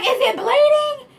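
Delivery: high, loud panicked question; alarmed question. Retold or imitated. Asking the waxer about the blood, high loud alarm. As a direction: ask it in a rush of panic.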